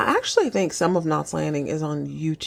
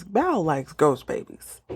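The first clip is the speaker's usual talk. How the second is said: slow, deliberate restatement of Val likes ghost babies; slow flat restatement; 2 semitones lower, slower than usual. Shown as a demonstration. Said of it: "repeat, slow and flat".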